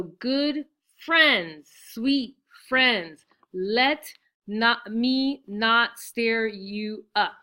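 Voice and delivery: "Good friends, sweet friends" slow, loud, each syllable held apart; syllable-by-syllable scansion. Shown as a demonstration. Teacher counting ten syllables for students, syllable-by-syllable scansion. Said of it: "There's ten".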